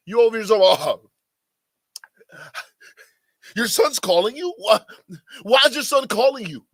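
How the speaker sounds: mocking outrage. Real, not acted. Host mocking the boyfriend in the clip, loud and incredulous. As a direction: throw it at him in disbelief.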